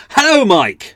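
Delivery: sudden loud, high-pitched shout; exclamation-mark shout. Shown as a demonstration. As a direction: yell the greeting as if it had an exclamation mark. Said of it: "It's a shout thing".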